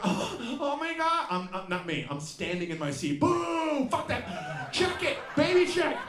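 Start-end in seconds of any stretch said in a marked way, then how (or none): 0.0-1.3 mock cooing crowd. Everyone cooing at baby. 3.2-6.0 high jeering boo like a sports fan; mock heckling. Comic stands in his seat booing the baby, high jeering.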